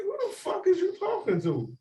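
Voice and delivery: high, sharp challenge thrown back over the shoulder; indignant retort. Retold or imitated. Man at the bar confronting the guy who insulted him, high indignant retort. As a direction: snap back in disbelief, pitched well up. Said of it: "I look back and I'm like".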